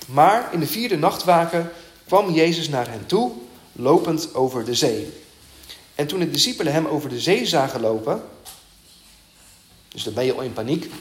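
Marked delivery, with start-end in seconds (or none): 0.1-8.2 scripture reading. Acted. Reads the scripture passage aloud.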